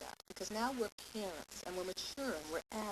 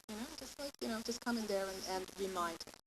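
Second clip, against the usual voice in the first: normal talking voice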